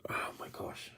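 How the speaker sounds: breathy hushed whisper; real whisper. Real, not acted. Dad realizes the door's open, whispering to viewers. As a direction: whisper it so the house stays quiet. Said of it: "I'm talking all soft".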